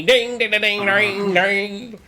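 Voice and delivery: sung high dings like plucked strings; guitar twang imitation. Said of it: "my guitar twang".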